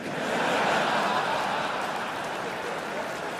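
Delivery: airy unvoiced laugh; laughing under breath; breathy laugh. Real, not acted. Laughter after punchline.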